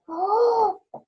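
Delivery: sharp shocked gasp; a little gasp at the villain. Real, not acted. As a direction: gasp in mock horror. Reading Grinch, gasps at evil.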